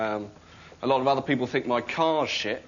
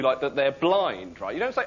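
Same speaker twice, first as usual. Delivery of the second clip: ordinary talk, nothing marked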